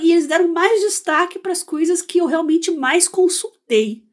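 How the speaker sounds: ordinary talk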